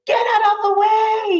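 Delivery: high, loud yell of the sheep. Acted. Sheep in a jeep yelling at the duck, loud and high. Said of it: "he yells".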